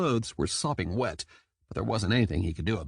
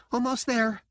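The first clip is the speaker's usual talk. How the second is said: high-voiced dolphin reassuring; high dolphin voice, a touch reassuring; promising; 13 semitones higher than usual. A narrator's reading. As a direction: promise it's nearly over, pitched high. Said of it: "the dolphin promised".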